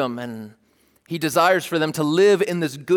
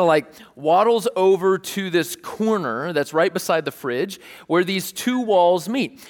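ordinary talk

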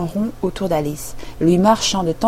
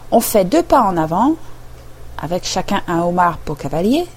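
usual speaking voice

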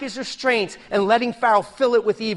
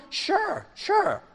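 mock-breezy, much higher "sure, sure"; an airy, overconfident answer; breezy confident; 11 semitones higher than usual. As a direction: brush off the danger lightly.

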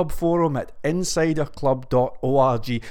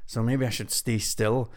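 normal talking voice

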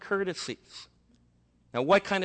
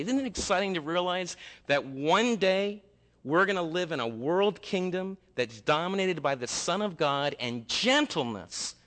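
ordinary talk, nothing marked

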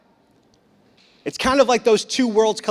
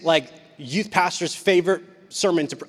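ordinary talk, nothing marked

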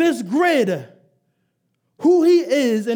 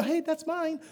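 timid version; 4 semitones higher, 10 dB quieter than usual. Shown as a demonstration. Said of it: "That's not what you do".

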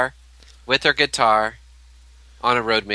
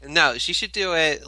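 usual speaking voice

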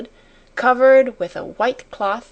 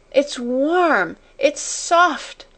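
high, overwhelmed exclamations, climbing; lightly acted near-sob of joy; almost sobbing; 6 semitones higher, much slower than usual. A narrator's reading. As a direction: pitch it up, overcome with relief. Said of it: "she almost sobbed".

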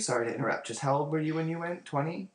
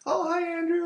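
high-pitched doting grandparent voice; high imitated hello over a video call; grandparent's greeting.

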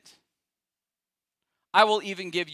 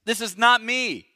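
loud, higher vehement protest; shouting Peter; 6 semitones higher, 7 dB louder than usual. Retold or imitated. Preacher as Peter shouting he will never fall. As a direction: shout the denial with full force. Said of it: "he's gotta be shouting".

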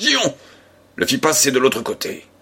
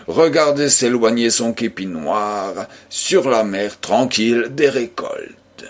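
ordinary delivery, nothing marked

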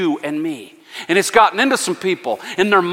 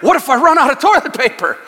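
fearful hoarder; 8 semitones higher, 4 dB louder than usual. Retold or imitated. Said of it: "the byproduct of that fear".

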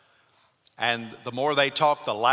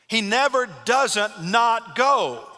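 high, louder and very slow; preaching emphasis; 9 semitones higher, 4 dB louder, much slower than usual. Acted. Preacher driving home Paul's resolve to the church. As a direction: lean hard on every word.

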